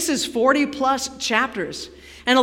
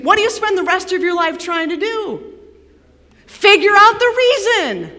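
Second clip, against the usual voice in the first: normal talking voice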